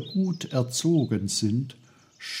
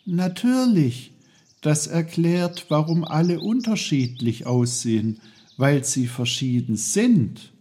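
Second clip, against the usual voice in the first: put-on lightly raised, curious boy's voice; a hint of a higher, friendly boy; young Peter, eager; 7 semitones higher, 5 dB louder than usual. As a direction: ask it politely like a boy talking to trees.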